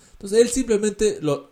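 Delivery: ordinary delivery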